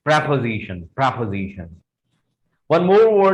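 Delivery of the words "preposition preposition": preposition said as "prep-uh-zi-shun", twice; correct pronunciation. Shown as a demonstration. Teacher modelling the word for students, properly pronounced. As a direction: keep the "prep" of preposition short. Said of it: "इसको बोलेंगे".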